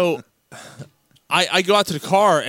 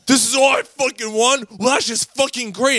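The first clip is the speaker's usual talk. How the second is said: high-pitched mock griping; retold freak-out; 10 semitones higher, 3 dB louder than usual. Storyteller mocks his friend over his winnings, imitated freak-out. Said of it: "And you freaked out".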